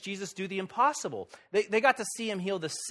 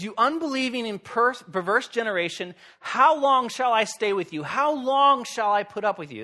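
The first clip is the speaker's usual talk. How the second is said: exasperated, louder and higher; frustrated rebuke; 6 semitones higher, 5 dB louder than usual. Acted. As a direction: scold them like a fed-up teacher. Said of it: "You can sense how frustrated he is".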